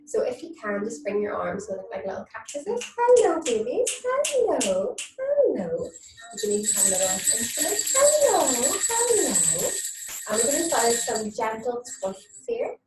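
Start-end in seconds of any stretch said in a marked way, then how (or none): 3.0-5.9 high, sing-song hello to the babies. Real, not acted. Greeting babies in baby talk. 8.0-9.7 high, sing-song hello to the babies. Real, not acted. Greeting babies in baby talk.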